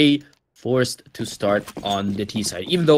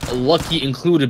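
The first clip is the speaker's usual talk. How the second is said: usual speaking voice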